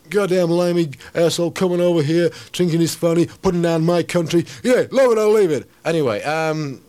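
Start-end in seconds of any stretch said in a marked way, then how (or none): 0.1-5.7 louder, higher jeering patriot; mock American heckler. Mimicking a New York heckler insulting a British poet. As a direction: rant like an offended local.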